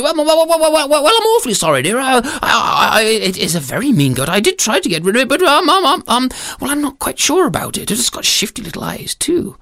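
high, dithering, stammering apology; flustered husband. Acted. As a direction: make hesitant excuses to your wife. Storyteller voices flustered husband.

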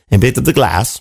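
ordinary delivery

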